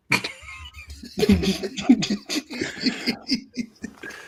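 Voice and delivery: helpless high-pitched laughter; laughing. Real, not acted. Podcaster cracking up at own stolen gun story, high laughing.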